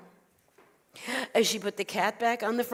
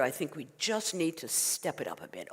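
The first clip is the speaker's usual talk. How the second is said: low, softer confiding rabbi voice; advising rabbi; 4 semitones lower, 7 dB quieter than usual. Acted. Rabbi advises the sleepless old woman, confiding. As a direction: lower and soften it as sage advice.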